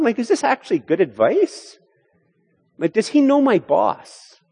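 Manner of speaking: high-pitched disbelieving questions; incredulous objector. Acted. Voicing a sceptical listener's objection.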